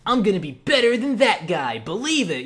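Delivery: high, hammed-up rival's vow; mock determination, loud and high at the start; competitive boaster. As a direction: declare it like an over-eager challenger. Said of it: "not really a very competitive person".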